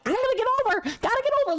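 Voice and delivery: very high squeaky mock driver; mocking oblivious driver. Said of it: "looking out their left window like".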